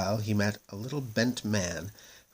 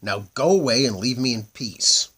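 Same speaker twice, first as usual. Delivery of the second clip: cross; 3 semitones higher, 7 dB louder than usual. A narrator's reading. Bent man sends prince away.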